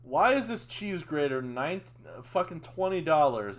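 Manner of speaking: loud, incredulous rant at a price; outraged at the cost; exasperated. Real, not acted. Outraged at cheese grater price.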